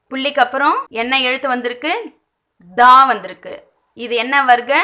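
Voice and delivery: ordinary talk, nothing marked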